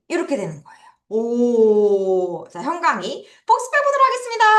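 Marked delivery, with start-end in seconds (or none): none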